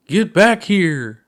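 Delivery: high, loud and slow playful shout; mock yell. Puppet pilot yells after his lost ship, mock shout. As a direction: holler after the runaway ship.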